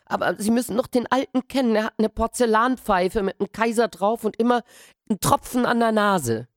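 put-on boy insists to the gardeners, high and loud; insistent boy. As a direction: make them believe you.

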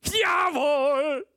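very high, very loud, slow on the last words; drunken shout. A narrator's reading. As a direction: roar it in drunken glee. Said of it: "schrie Benkiu".

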